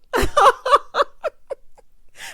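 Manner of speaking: high, loud, long cackle; real laugh. Real, not acted. Host amused by Michaela's look, cackling loudly. Cracking up at own retelling.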